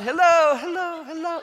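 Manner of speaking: high sing-song hello; calling up the slide. Retold or imitated. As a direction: call up to her playfully. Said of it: "I'm in the slide".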